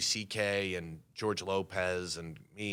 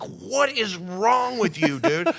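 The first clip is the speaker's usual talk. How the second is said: much higher, louder, slow scolding; exasperated manager; 7 dB louder, much slower than usual. Retold or imitated. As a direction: manager scolding him after the hug. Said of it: "my manager's like".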